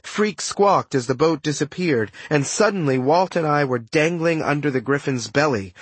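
usual speaking voice

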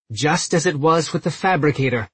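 ordinary delivery, nothing marked

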